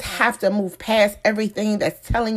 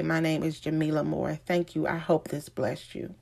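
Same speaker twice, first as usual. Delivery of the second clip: ordinary delivery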